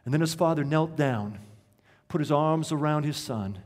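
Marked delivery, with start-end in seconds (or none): none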